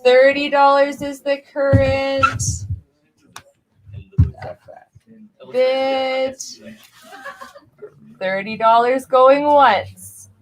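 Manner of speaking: calling out bids to the room; auctioneer call. Acted. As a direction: ask for the next bid like an auctioneer.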